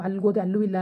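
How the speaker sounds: ordinary talk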